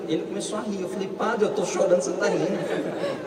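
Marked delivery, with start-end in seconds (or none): 1.1-3.3 laughing complaint. Retold or imitated.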